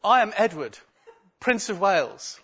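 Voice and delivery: a hint of a posh little boy; proud little prince. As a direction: announce yourself like a small royal. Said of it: "So the first one goes".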